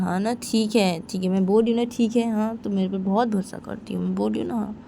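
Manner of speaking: ordinary delivery, nothing marked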